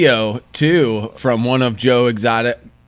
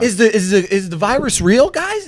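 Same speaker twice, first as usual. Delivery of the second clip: mock catastrophizing; 7 semitones higher, 4 dB louder than usual. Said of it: "panicky questions about the virus".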